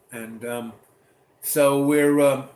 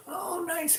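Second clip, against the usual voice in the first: sweet mom voice; 4 dB quieter than usual. Retold or imitated. Mom replies to kid.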